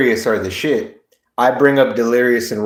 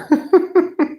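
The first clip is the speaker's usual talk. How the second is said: high-pitched helpless laugh; long, high giggle fit; real laughing fit. Real, not acted. Breaking up mid-joke, high helpless laughter.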